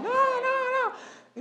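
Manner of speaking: very high, stretched-out mock wail of protest; slow, high crowd objection, mimicked; imitated protesting crowd. Crowd wants to keep watching the fight, imitated protest. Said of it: "porque la gente quería la acción".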